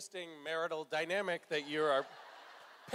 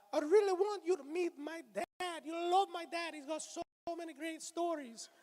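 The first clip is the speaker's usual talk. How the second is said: hand puppet guy voice; 10 semitones higher than usual. Acted. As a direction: play the creepy hand puppet pitching massages, pitched way up. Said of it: "he's always saying".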